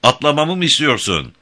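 high-pitched called question; mild narrated shout, lifted in pitch. A narrator's reading. Louisa asking Jim if she should jump, calling out. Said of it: "diye bağırdı Louisa rüzgara karşı".

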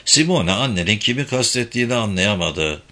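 plain reading voice

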